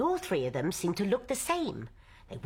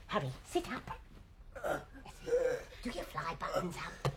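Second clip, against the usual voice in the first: hushed orders; 9 dB quieter, faster than usual. Acted. Wife tidying husband before guests.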